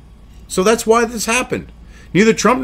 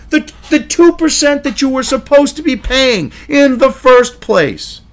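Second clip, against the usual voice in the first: indignant retort; 6 semitones higher, 3 dB louder than usual. Real, not acted.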